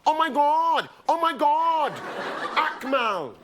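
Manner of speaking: hammed-up fan shrieking at a comedian in the street; excited fan. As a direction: gush like she's spotted a celebrity. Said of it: "she goes".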